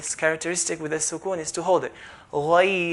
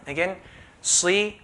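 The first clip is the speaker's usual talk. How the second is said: ordinary talk, nothing marked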